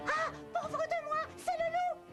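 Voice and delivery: high, drawn-out cry of alarm; cartoon victim spotting danger; panicked little pig. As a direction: wail in theatrical fright. Pig discovers the wolf outside.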